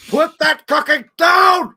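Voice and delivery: very high, loud, slow bark; mock Austrian roar at the kids; Schwarzenegger impression. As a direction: bellow it like an Austrian action hero. Quoting Schwarzenegger movie line.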